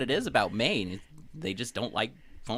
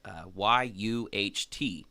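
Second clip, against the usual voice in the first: ordinary delivery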